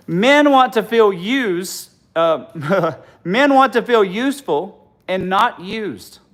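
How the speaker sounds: slow, loud, laughing through it; laughing at her own joke; laughing punchline. Real, not acted. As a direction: drag the punchline out while cracking up.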